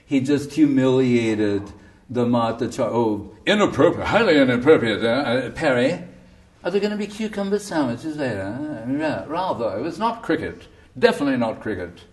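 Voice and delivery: prissy, mock-offended upper-class voice with a laugh; mocking posh Englishman. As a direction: parody a scandalised English gentleman. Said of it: "All you can say is".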